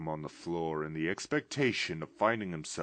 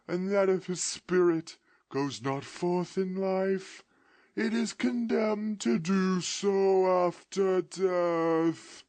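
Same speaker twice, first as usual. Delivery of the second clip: slow, high, loud mournful wail; ghost's voice; 13 semitones higher, 8 dB louder, slower than usual. Acted. Ghost lamenting its fate to Scrooge in a slow wail. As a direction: lament your doom from the grave.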